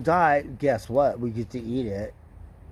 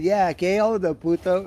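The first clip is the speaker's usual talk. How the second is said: cheeky retort; 7 semitones higher, 5 dB louder than usual. Retold or imitated. Retold cheeky reply in Spanish.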